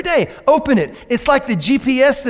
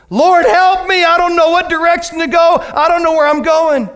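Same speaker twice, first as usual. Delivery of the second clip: loud, high pleading cry; lost believer calling out to the Lord; desperate plea; 9 semitones higher, 4 dB louder than usual. Acted. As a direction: cry out to God for help. Desperate prayer acted out.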